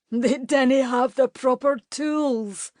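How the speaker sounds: mocking echo. A narrator's reading. Alasdair parrots Katie's excuse, slow mocking echo. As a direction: parrot her words to ridicule her. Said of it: "continued to roar with laughter".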